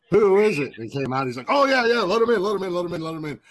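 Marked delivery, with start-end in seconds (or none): 0.1-0.8 higher, louder mock shout; imitated call. Retold guard calling out at door. 1.5-3.4 high, hurried mock excitement, easing off as it repeats; imitated eager welcome. Retold eager okay to admit guests.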